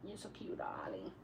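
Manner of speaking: unvoiced whisper to the cat. Real, not acted. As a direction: breathe it tenderly.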